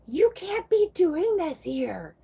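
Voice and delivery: put-on high, slow, scolding old lady; angry and mad. Imitating the old lady at the post office, slow high anger. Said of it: "And angry and mad".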